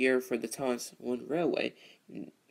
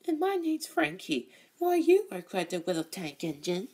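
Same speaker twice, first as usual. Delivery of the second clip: high, cheeky put-on voice; Frankie voice; 11 semitones higher than usual. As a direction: pitch it way up for Frankie. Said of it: "then I could do Frankie".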